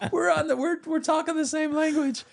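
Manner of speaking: high, laughing; gush, overjoyed; giddy excitement. Real, not acted. Giddy about theater talk.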